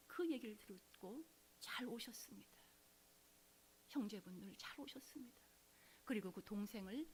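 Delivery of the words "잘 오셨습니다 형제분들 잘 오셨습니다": a hushed, whispered welcome from the pastor; soft welcome. Retold or imitated. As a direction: welcome them gently, almost in a whisper. (목사님이 형제들을 속삭이듯 맞이하며)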